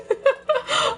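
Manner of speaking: a sudden high squeal of laughter; high burst of laughter. Real, not acted. Streamer cracking up at chat, squealing laugh.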